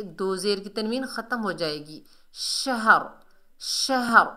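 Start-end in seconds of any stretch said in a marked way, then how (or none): 2.3-4.3 शहर with heavy final r, no ending vowel; pause-form demo. Shown as a demonstration. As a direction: model शहर deliberately and stop on the r. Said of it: "ऐसे करके पढ़ेंगे".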